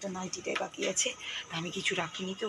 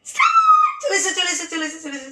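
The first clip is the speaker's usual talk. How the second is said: much higher, louder call to start the game; excited shout; 10 semitones higher, 11 dB louder than usual. Real, not acted. Mother starting hide-and-seek with her toddler, excited shout. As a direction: belt it out like kicking off a race.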